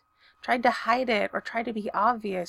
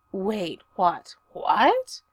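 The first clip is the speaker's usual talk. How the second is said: mildly indignant outburst; a touch of fury, pitch climbing at the end; furious; 4 dB louder, much slower than usual. A narrator's reading. Stiles protesting at Derek, mildly furious. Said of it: "said Stiles furiously".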